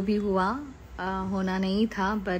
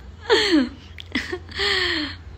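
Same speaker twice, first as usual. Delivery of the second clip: long high laugh that nearly tips into crying; 8 semitones higher than usual. Real, not acted. Asked what she whispered in Prateek's ear, laughing helplessly. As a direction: laugh helplessly before answering.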